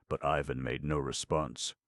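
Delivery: ordinary delivery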